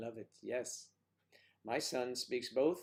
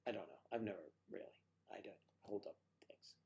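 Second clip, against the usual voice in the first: ordinary delivery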